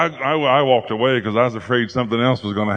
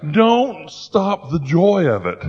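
ordinary talk, nothing marked

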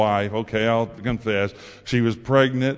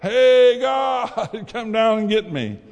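hollering for help; 10 semitones higher, slower than usual. Retold or imitated. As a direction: yell up for rescue, half laughing at the end. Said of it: "all he had left to do was holler".

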